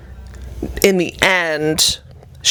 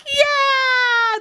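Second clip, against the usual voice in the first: very high, drawn-out relieved yeah; mock relieved yeah. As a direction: stretch it out in fake relief. Passengers relieved, plane goes flat.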